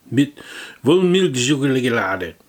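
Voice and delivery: slow loud German product read; hammed-up German; mock German accent. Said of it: "mock German product name".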